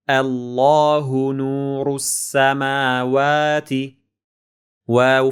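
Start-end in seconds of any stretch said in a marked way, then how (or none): none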